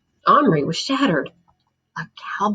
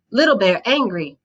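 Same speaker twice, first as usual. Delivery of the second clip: ordinary delivery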